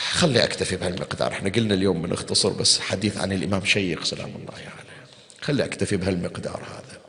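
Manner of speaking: ordinary talk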